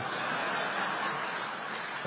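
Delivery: soft breathy chuckle; quiet laugh. Real, not acted. President chuckling at his medal joke. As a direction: chuckle at your own line.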